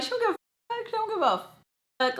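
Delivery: very high mock aside. Storyteller mocking the customer nodding at her phone, high mocking voice. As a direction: pitch it way up to mock her indifference.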